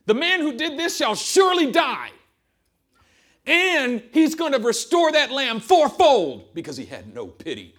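loud, high furious shout; king roaring a death sentence. Acted. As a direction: rage at the unknown thief. King's furious verdict.

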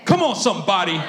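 loud preacher call to the room; rally the crowd, loud; loud call to the congregation. Real, not acted.

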